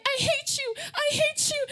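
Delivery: high, loud tearful mock tantrum; upset American TV teen. Imitating a TV youngster storming off, mock-hysterical. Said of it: "whenever somebody is upset ... they run away".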